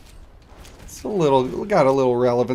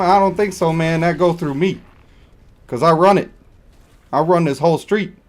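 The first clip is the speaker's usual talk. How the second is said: higher, louder mock street tough; urban tough guy; 4 semitones higher, 4 dB louder than usual. Streamer playing a street tough to a viewer, louder swagger. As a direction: strut it like you run the block. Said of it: "You want it to be a little more urban?".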